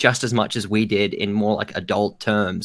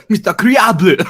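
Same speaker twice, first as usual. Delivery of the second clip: put-on laughing thick French accent; high, loud French accent; 9 semitones higher, 7 dB louder than usual. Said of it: "thick French accent".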